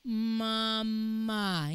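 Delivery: drawn-out, dragging Mama; too slow. Shown as a demonstration. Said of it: "Hindi yung mabagal na".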